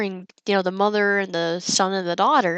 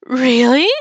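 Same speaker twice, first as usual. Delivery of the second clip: loud, higher-pitched disbelief; amused, mock-stunned reaction; incredulous; 4 semitones higher, 7 dB louder than usual. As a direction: question it as if it's absurd. Disbelief at the forced dinner-table wait.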